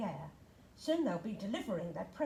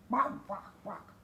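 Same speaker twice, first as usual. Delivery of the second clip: puppy barking; 3 dB louder, slower than usual. Acted. Narrator voices puppy barking.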